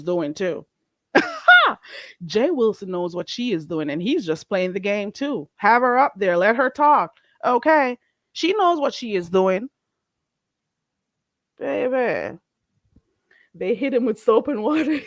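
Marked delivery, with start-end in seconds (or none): 1.1-2.2 high cackling laugh. Real, not acted. Laughs at her own joke. 11.6-15.1 laughing. Real, not acted. Joke told while laughing.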